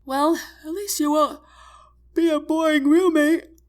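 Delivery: words stretched by a yawn. Acted. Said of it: "she mumbles as she lets out a huge yawn".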